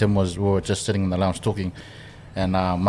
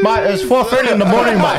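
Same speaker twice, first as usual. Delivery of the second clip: high-pitched, raised mock complaint; acted complaint; 12 semitones higher, 4 dB louder than usual. Friend retelling a four-thirty wake-up, high mock complaint. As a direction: put on a high, loud protesting voice. Acted quote in fresh-people story.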